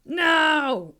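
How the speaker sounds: loud high "No!"; mock dinosaur shout; dinosaur yell. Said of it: "dinosaurs yelling".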